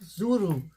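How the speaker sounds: "Zuru" avec le r roulé. Real, not acted. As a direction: rouler le r de "Zuru" à la tahitienne. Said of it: "je roule les R comme, comme les Tahitiens". ("Zuru", r roulé comme à Tahiti)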